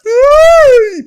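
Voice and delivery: loud, very high drawn-out shriek; high-pitched squeal. Real, not acted. Reacting to Boban's play, a high excited shriek.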